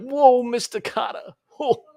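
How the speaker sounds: high, put-on whoa in a sitcom voice; a high goofy impression voice; Mister Cotter voice. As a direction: imitate a goofy sitcom student. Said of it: "Mister Cotter kind of voice".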